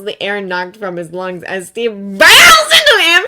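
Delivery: usual speaking voice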